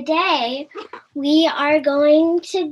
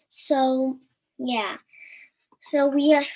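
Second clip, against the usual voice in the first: ordinary delivery, nothing marked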